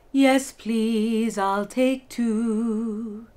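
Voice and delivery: sung smiling, slowly stretched; with a smile. Shown as a demonstration. Teacher singing an order with a smiling tone. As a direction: sing it with a smile. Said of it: "with a smile".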